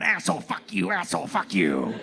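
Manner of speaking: lightly voiced ranting stranger; a hint of a deranged man cursing. Street stranger cursing at passersby, mock rant. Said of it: "a clearly deranged man came around the corner going".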